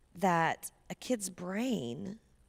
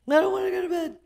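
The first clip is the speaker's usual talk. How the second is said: put-on high, loud, whining child protesting bedtime; whiny child; 13 semitones higher, 12 dB louder, much faster than usual. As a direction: act out a child wailing that he won't go to bed. Mother voices son refusing bed.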